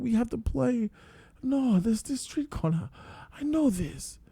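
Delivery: put-on high, soft, wandering old man's voice; a dreamy, lost old man remembering; confused old man. The old man recognising his old village, high and dreamy.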